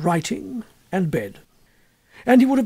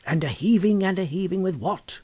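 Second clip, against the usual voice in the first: slow, hushed storyteller's dread; old teller's eerie voice. Retold or imitated. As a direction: lean in like an old man telling a ghost tale. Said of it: "He says".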